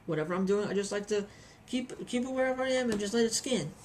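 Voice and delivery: ordinary delivery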